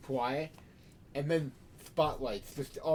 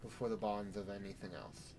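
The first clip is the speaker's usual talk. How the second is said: sermon; 5 dB quieter than usual. Acted. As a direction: preach it with measured pauses. Sheriff preaching to vampires.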